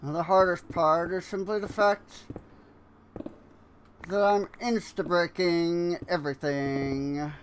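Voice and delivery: a hint of a smug cartoon villain musing; Fat Cat villain voice. Gamer musing at his build in a mild cartoon villain voice. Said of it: "no idea what that accent is supposed to be".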